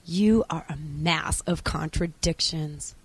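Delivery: near-whisper, low; hushed. Acted. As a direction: breathe it out softly and intimately. Acted ending, intimate address.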